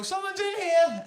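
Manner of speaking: high, slow, drawn-out call of someone in the stall; mock occupant call. Stall occupant calls out through the door, high and slow. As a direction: sing it out high and slow, like someone stuck on the toilet. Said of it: "they inevitably always go".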